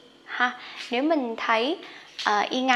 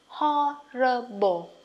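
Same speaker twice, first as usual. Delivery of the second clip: Horrible drawn out slowly, syllable by syllable; slow model of Horrible; much slower than usual. Shown as a demonstration. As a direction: stretch Horrible for learners. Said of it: "Đọc nhanh nè".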